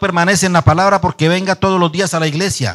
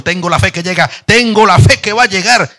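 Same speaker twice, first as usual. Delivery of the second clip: singsong, higher and louder pious chant; mock pious believer; 4 semitones higher, 5 dB louder than usual. Preacher mocking a believer who just waits for God. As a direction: sing out your faith, a little smug. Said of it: "algunos son más espirituales".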